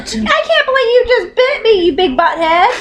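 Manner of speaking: high-pitched laughing protest; real shocked protest, laughing and high; laughing outrage. Real, not acted. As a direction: scold the bird through laughter. Just bitten, laughing protest.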